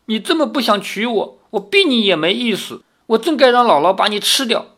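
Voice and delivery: high, hurt voice with a stammered start; choked up. A narrator's reading. Girl reproaching her reluctant suitor, choked up. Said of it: "哽咽着说".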